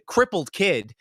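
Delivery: ordinary delivery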